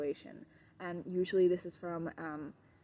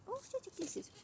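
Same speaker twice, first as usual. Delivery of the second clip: very high, soft coo; 8 dB quieter than usual. Real, not acted. Trainer getting dog kisses, very high soft coo. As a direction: squeak fondly at the kisses.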